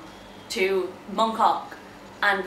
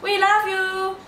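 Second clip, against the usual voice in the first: much higher adoring praise; adoring strangers; 9 semitones higher, slower than usual. Retold or imitated. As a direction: gush at her in the street. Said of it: "or going".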